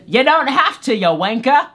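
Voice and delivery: high, loud mock British voice; British accent. Jerry answering the dreamer, exaggerated British accent. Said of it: "he turned British".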